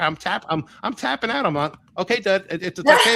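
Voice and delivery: high-pitched, stumbling plea to be let go; hammed-up tapping out of a crushing hug; mock panicked pleading. Acts out being crushed in hug.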